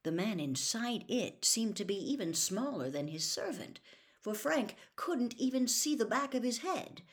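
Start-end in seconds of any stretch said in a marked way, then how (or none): none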